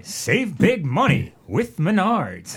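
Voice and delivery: slow, punchy commercial voice; TV ad announcer. Retold or imitated. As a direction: sell it like the old ad. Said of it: "He's the guy".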